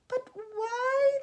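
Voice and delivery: very high, loud protest; shouted. A narrator's reading. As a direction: shout it in alarmed bafflement. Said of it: "they all shouted at once".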